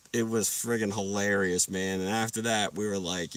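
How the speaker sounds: ordinary talk, nothing marked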